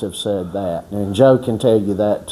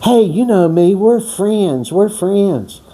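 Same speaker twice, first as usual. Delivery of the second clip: put-on a higher, wheedling drunk plea; retelling the alcoholic sidling up to the director, higher; imitating the drunk; 7 semitones higher, slower than usual. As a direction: mimic a drunk insisting on friendship. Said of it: "the raging alcoholic".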